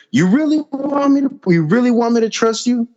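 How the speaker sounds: high mock dad, really doubting; dad's doubting voice. Son retells dad doubting him, high skeptical voice. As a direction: raise the pitch to play a skeptical father.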